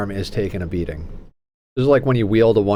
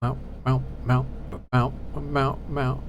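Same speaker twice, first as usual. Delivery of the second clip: soft coaxing; 7 dB quieter, slower than usual. Real, not acted. Coaxing cat softly.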